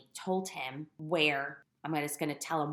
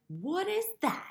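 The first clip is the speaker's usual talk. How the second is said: much higher, startled what is that; startled question; 14 semitones higher than usual. Retold or imitated. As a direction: recoil at a sudden strange sensation. Startled by unexpected taste.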